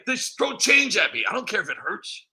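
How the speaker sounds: ordinary talk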